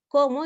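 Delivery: "¿Cómo?" lanzado alto y fuerte; emphatic Cómo. Shown as a demonstration. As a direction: suelta el "Cómo" con asombro marcado. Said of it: "expresa énfasis". ("¿Cómo?" enfático, agudo y fuerte)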